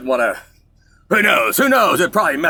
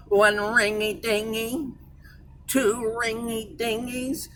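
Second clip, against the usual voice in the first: higher, softer, slow nasal-free operator sing-song; mock telephone operator, drawn out slowly; operator impression; 8 semitones higher, 6 dB quieter, much slower than usual. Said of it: "the one girl would go".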